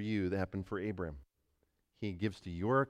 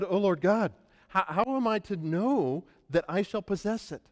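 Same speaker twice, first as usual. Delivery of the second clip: Abram pleading; 10 semitones higher, 5 dB louder than usual. Acted.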